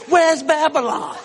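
high, drawn-out comic question; eager men calling out. Retold or imitated. Three guys ask after the denounced woman's origin, comically eager. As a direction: imitate lustful guys keen for directions.

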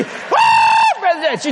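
very high, drawn-out whoa; over-the-top shriek of greeting; excited mock holler. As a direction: whoop it out sky-high like an excited woman. Repeats the woman's excited call.

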